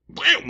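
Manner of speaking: high, sudden "wham"; imitated slam. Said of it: "that was just like".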